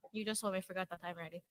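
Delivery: normal talking voice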